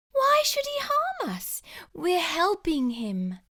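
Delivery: small high voice, slowing at the end; high mousy voice. Acted. Wife doubting the danger, high mousy voice. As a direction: squeak it, puzzled and gentle.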